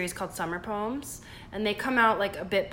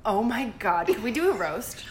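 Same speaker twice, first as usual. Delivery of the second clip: ordinary talk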